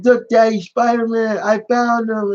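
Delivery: put-on high, eager little kid voice; a hint of a thrilled toddler calling the hero; excited little boy. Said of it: "and he like".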